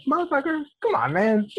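high-pitched mock exasperation; exasperated retort. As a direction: plead in disbelief, acting out the comeback. Retold exasperated reply to method actor.

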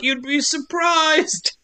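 put-on high, slow little kid voice. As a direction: play a small child, drawn out. The kid answers in a voice.

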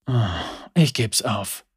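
sighing Lucian. A narrator's reading. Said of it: "seufzte er".

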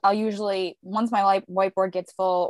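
usual speaking voice

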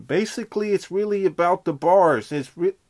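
high, loud rapper brag; high rapper impression, still rough. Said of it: "it's coming".

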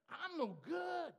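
high, slow shout; self-loathing shout. Acted. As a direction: hurl it out in despair.

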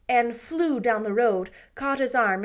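ordinary delivery

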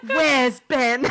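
loud mock British mom; attempted English accent. Retrying the mom's question, attempted English accent. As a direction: have another go at an English accent. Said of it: "I can't even do the accent".

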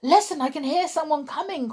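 high, urgent warning; sudden alarm. Acted. Bear warning the toys someone is coming, alarmed. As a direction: alert the others in a hurry. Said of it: "cried the bear suddenly".

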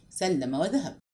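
ordinary delivery

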